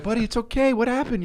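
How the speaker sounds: put-on high, gentle voice for a small child; soothing parent. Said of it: "And I'm like".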